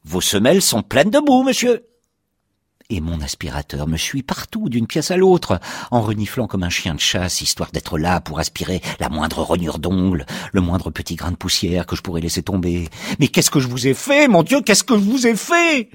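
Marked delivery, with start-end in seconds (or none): 0.1-1.8 léger reproche aigu de porte; porte qui engueule. Retold or imitated. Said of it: "Je me fais engueuler par ma porte". 13.2-15.9 plainte de plus en plus aiguë; supplication désespérée. Acted.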